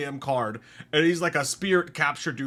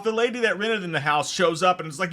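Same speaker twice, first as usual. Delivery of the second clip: ordinary talk, nothing marked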